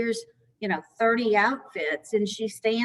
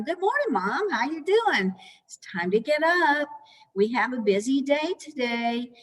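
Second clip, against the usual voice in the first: bright, higher, cheerful greeting; friendly positive tone; 4 semitones higher than usual. Shown as a demonstration. Caregiver waking Mom, bright and higher cheerful greeting. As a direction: sound upbeat and welcoming, voice lifted high. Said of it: "Try to be positive and use a friendly tone".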